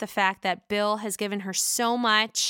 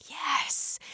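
whisper; 5 semitones higher, 4 dB quieter than usual. Real, not acted. Whispered aside to viewers.

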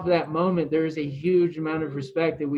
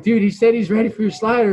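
higher, louder insistent teammate; raised voice, pressing the news on him; acted-out disbelief; 8 semitones higher, 8 dB louder than usual. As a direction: retell it as an amazed teammate.

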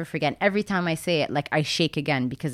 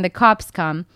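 normal talking voice

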